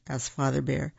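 usual speaking voice

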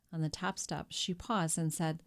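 usual speaking voice

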